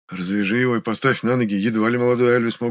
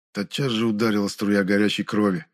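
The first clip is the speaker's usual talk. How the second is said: ordinary delivery, nothing marked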